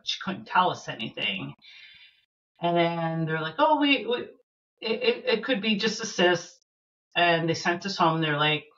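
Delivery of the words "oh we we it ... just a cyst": higher-pitched, stammering retold hedge; hesitant reassurance. Mother retelling what doctors said after the ultrasound, hesitant. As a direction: lightly imitate doctors hedging, voice lifted.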